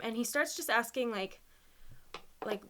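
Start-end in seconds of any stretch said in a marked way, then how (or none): none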